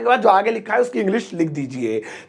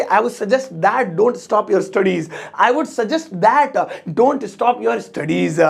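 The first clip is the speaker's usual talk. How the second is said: slow, word-by-word example sentence; drawn-out English model; slow teaching model; much slower than usual. Shown as a demonstration. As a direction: say it slowly for learners to copy.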